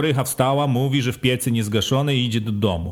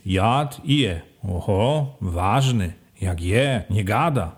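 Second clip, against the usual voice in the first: ordinary delivery, nothing marked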